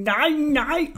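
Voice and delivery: put-on boy crying no no while dangling; frightened shout. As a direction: cry out in panic. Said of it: "råbte Orla".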